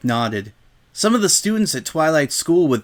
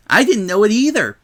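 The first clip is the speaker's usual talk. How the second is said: higher and louder, bouncy pony chirp; Pinkie Pie voice; 6 semitones higher, 5 dB louder than usual. Acted. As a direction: pop in eagerly, high and bright. Pinkie chimes in excitedly.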